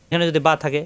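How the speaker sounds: ordinary talk, nothing marked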